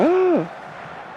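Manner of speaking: sharp, high mock gasp of the crowd; a crowd gasping in shock, mimicked; imitating a gasp. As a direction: act out the audience's shocked intake of breath. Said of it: "and people were gasping".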